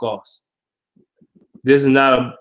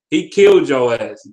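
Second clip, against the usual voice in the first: ordinary delivery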